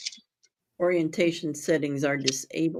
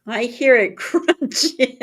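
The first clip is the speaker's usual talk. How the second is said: laughing; 5 semitones higher, 4 dB louder, slower than usual. Real, not acted. Teasing someone eating on mic.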